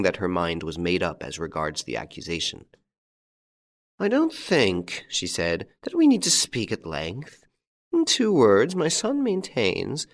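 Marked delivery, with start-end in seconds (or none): 4.0-10.1 much higher, cold and slow stern matron; a tall angular woman passing cold judgement; hostile old mother-in-law. A narrator's reading.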